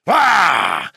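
loud, high, enraged cry; violent cry. Acted. Priest rejected by the girl, crying out violently. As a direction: let out a violent yell. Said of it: "Il pousse un cri violent".

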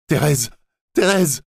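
high, piercing cries for help; desperate cry. A narrator's reading. Camille drowning, crying out to Thérèse in terror. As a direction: scream her name in panic. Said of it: "a-t-il crié".

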